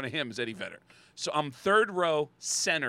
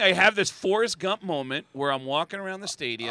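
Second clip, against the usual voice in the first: ordinary talk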